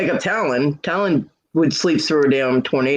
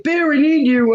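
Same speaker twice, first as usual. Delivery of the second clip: high-pitched pitiful call; mock plea for help; 12 semitones higher, 4 dB louder than usual. Man with cactus in his face calling Bear, mock plea. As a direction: call out needily, hammed-up.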